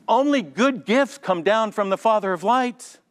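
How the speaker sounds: quoted verse. Retold or imitated. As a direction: lift the voice to recite scripture.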